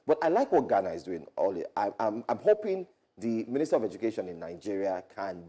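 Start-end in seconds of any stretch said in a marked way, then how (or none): none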